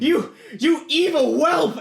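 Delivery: slow, very high shouted curse; outraged yelling. Acted. Knight cursing the boy who betrayed him, outraged yelling. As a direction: stretch the insult into a high yell.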